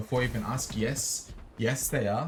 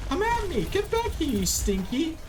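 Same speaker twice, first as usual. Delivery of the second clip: high squeaky taunt. Pretend. Streamer taunting a fleeing enemy in game, squeaky and high.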